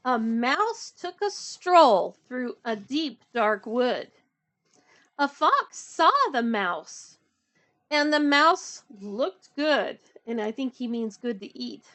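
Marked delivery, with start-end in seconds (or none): 0.1-10.0 slow, clear read-aloud narration; storybook reading. A narrator's reading. Reading The Gruffalo aloud to children, slow storybook voice.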